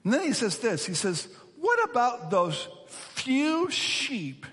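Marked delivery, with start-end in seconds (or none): 1.6-4.5 slow, high, pointed jab, acted; drawn-out accusing question, easing at the end; angry older brother. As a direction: needle him with mock angry scorn. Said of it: "Notice his anger has turned".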